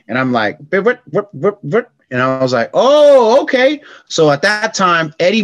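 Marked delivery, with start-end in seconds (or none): 0.7-1.9 scratch noises. Retold or imitated. Imitating a scratch sound. 2.7-3.8 high, loud burst of surprise; surprised delight. Retold or imitated. Kid hearing his first scratch, high surprised squeal.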